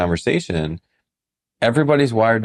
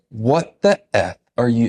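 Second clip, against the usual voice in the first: slow, higher, stern imitation of the client; stern angry quote; 4 semitones higher, slower than usual. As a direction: lean into his strong demeanor. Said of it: "strong demeanor".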